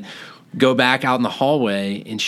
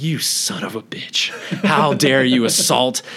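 hushed outrage; 2 semitones higher, slower than usual. Retold or imitated. Retold indignant reaction to the doctor.

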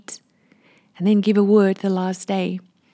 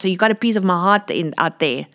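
ordinary delivery

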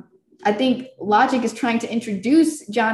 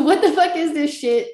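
incredulous; 7 semitones higher, 4 dB louder than usual. Retold or imitated. Incredulous quote to friend.

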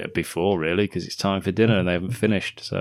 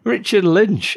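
much higher, louder exclamation; excited repeat; 12 semitones higher, 6 dB louder, slower than usual. Real, not acted. Co-host repeating the villain's name after the reveal, excited. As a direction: hit the name high and loud, in real delight.

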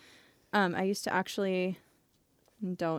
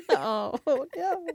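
laughing; 10 semitones higher, 6 dB louder than usual. Real, not acted. Laughing at dark joke.

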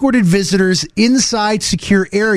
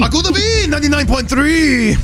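mock radio jingle; 6 semitones higher than usual. Radio station jingle parody.

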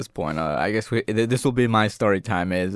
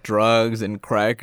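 normal talking voice

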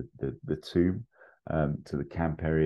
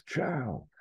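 high, falling cry held back in volume; restrained, high-pitched yell; mock yell; 10 semitones higher than usual. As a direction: call out the name high without really shouting. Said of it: "and Indy yells".

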